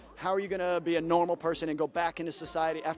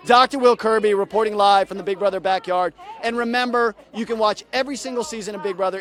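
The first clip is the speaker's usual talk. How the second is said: a hammed-up live reporter's sign-off; a mock news sign-off from the backyard; 7 dB louder than usual. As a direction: announce yourself like a field reporter on air. Closing announcer read.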